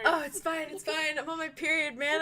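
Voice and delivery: flustered near tears. Real, not acted. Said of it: "you're gonna make me cry".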